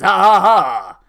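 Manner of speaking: loud shouted evil cackle; villain laugh, shouted. Retold or imitated. The minion mocking the party, shouted evil laugh. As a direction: end the taunt on a triumphant evil laugh.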